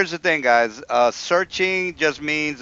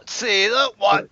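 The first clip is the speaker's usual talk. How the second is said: ordinary talk, nothing marked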